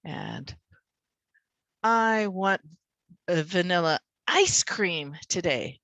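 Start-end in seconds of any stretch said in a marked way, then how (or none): 1.8-5.8 single out "ice cream" against any other dessert; stress on ICE CREAM. Shown as a demonstration. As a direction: hit "ice cream" hard. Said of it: "not the pudding".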